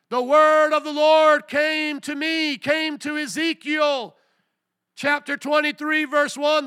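high, slow booming shout; drawn-out pulpit yelling; preacher at full force. Real, not acted. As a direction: hammer out each word to the congregation. Preacher shouting scripture and questions.

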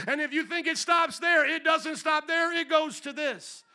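loud, high and slow preaching climax; preaching at full force. Acted. Preacher warning that the curses go further, loud and slow. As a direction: drive the last point home.

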